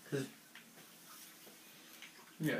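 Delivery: ordinary delivery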